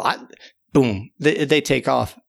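ordinary delivery, nothing marked

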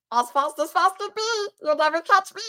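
high, taunting little character; put-on character voice. Shown as a demonstration. Co-host showing off her one voice, high cartoon taunt. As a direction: pitch it up and tease the chaser. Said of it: "I do have one voice. Let me see".

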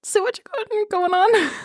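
high, teasing flirt; flirty tease. Retold or imitated. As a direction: purr it like a playful come-on. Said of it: "I will say it was like".

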